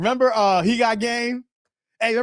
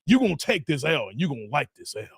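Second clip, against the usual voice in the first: low, mock stern guard; imitated enforcer, dropping lower; Jay; 6 semitones lower, faster than usual. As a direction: lay down the law in a lowered voice. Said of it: "Jay's like, you know, the guard on that one".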